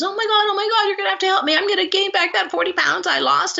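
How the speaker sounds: high-pitched panicked rush of words; panicking. Retold or imitated. Client bursting into therapist's office, panicking high. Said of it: "and she's panicking".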